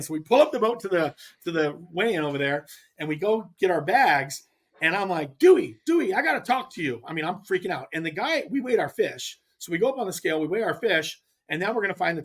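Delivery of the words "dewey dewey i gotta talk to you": Dewey, Dewey called out higher and louder; calling out. Retold or imitated. Calling the tournament director over at weigh-in, loud call. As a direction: flag him down across the weigh-in.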